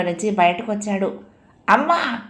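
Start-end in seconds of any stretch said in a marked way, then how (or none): 1.7-2.2 high, short call for mother; child's high-pitched call, falling at the end; loud call. A narrator's reading. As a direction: call out sharply to Amma. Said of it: "గట్టిగా పిలిచాడు".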